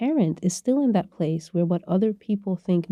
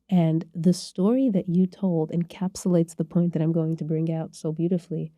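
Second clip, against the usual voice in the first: ordinary delivery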